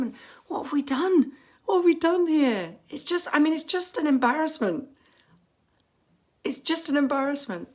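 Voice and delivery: indignant outburst at the government; exasperated outrage. Real, not acted. Exasperated rant about the government.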